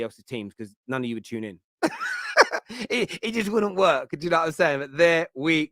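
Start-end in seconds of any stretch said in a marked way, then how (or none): 1.8-2.8 sudden high burst of laughter; real high laughing shout. Real, not acted. Laughs at own joke. 2.9-4.1 laughing. Real, not acted. Laughing after own joke.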